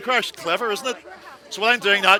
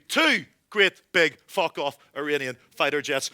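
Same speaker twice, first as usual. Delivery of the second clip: ordinary delivery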